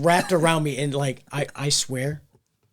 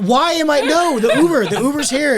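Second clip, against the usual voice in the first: higher, louder panicked protest; flustered protest; 11 semitones higher, 7 dB louder than usual. Retold or imitated. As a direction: scramble to get out of it. Panicked protest over the hug.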